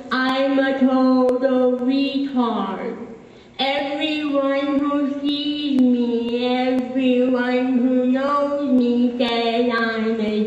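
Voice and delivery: very slow, drawn-out words on sustained tones; heavily elongated, almost chanted delivery. Acted. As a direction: stretch every syllable out laboriously.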